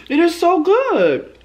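high, loud, drawn-out raving; delighted rave. Real, not acted. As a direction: squeal it slowly, hitting every word. Raving about the first bite.

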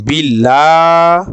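বিল্লাহ chanted slowly with a thin লা; light Quranic recitation. Shown as a demonstration. Teacher demonstrating the thin লাম, slow chant. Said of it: "বাংলার ল আকারের মতো উচ্চারণটি হবে".

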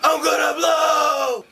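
high, slow strained yell; slow panicked shout. Acted. Taliban about to explode, slow high shout. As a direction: drag it out before exploding.

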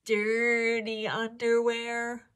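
ordinary delivery

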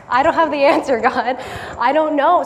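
loud, high, laughing "I don't know"; laughing panic. Retold or imitated. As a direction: laugh out the helplessness, loudly. Retold panic at slide.

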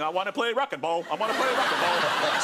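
lost, whiny chant; whiny confused American. Retold or imitated. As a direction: wander about whining it.

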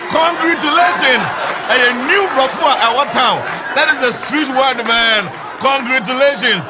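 high, amused crowing over a word; gleeful exclamation. Real, not acted. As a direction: shout it merrily, still tickled. Gleefully repeating the funny word.

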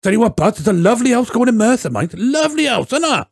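high, sing-song Welsh salesman; Welsh instructor. Retold or imitated. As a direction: sell the house in a chirpy Welsh lilt. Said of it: "he was like".

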